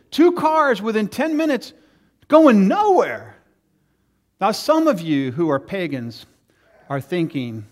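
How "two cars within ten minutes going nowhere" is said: high, loud, slowing on going nowhere; comic exasperation. Real, not acted. Preacher milking the car story for the congregation, high, loud and slowing. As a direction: build it up to the punchline.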